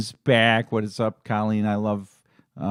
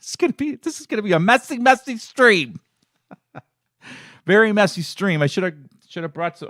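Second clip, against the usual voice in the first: laughing through real tears, much higher; choked up; 10 semitones higher, 3 dB louder than usual. Real, not acted. Streamer tearing up before his viewers, choked laughing voice. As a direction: voice high and tight, breaking into a laugh. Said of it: "See, I'm getting choked up already".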